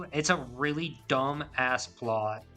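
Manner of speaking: ordinary talk, nothing marked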